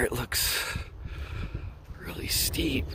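soft whisper, awed. Real, not acted. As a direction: whisper it looking up. Looking up at mountain.

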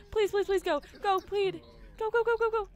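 loud, high, rapid please and go; frantic urging. Real, not acted. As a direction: hurry him on desperately. Urging character to plead urgently.

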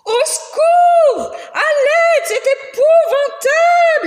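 very high, loud shrieks for help; panicked screaming. Acted. Visitors panicking at the museum, high loud screams. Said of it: "hurlèrent les visiteurs".

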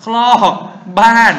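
high, loud word-by-word recitation; punchy high-pitched classroom drill. Shown as a demonstration. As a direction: call out each memory word loudly, held long. Repeating the drilled memory phrase.

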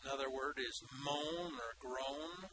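normal talking voice

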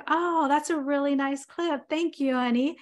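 husband politely thanking his wife for the clip. Retold or imitated. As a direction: offer a polite, dutiful thank you. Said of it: "he's like".

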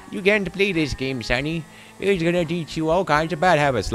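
high-pitched scolding mock granny; badly faked granny. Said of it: "I can't do a granny voice".

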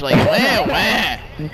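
mock crybaby wailing; taunting fake crying sounds; whiny mock sobs at the enemy team; mock baby crying. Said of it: "I've heard a toddler cry less than you".

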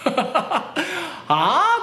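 high, stretched "huh?"; baffled reaction. Retold or imitated. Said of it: "と思って". (面食らった高い声)

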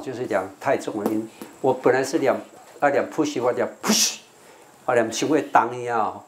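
usual speaking voice

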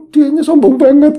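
high, loud mock outrage; retold huffy outburst, pitched high; indignant complaint. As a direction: imitate an affronted friend, loudly.

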